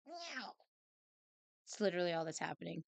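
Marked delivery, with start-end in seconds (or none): 0.0-0.5 meow. Acted. Meowing at the cat.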